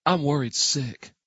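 a hint of a dry woman's voice; lightly voiced woman. A narrator's reading. Brett voiced in story.

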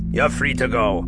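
lightly voiced surly guard; churlish guard. A narrator's reading. Guard releasing two old prisoners, mildly churlish. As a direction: announce it with a touch of churlishness. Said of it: "the guard announced churlishly".

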